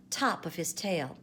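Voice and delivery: ordinary delivery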